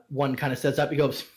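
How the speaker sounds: normal talking voice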